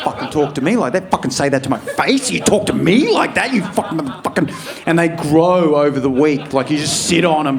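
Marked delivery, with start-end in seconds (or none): 0.0-4.5 muttered revenge rant. Retold or imitated.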